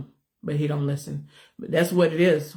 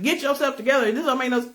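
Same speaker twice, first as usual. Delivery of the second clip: retold scolding; 7 semitones higher, faster than usual. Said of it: "I've screamed".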